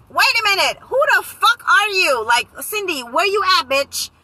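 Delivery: high, loud panicked yelling at herself; body shouting at her mind, high and loud; frantic self-scolding. Retold or imitated. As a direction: snap yourself out of it, loudly. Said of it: "my body was over here saying".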